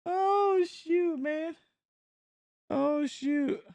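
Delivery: a sudden high yelp fading into oh shoot; startled. Real, not acted. Streamer startled by something on screen, sudden yelp. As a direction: caught off guard, cry out then settle.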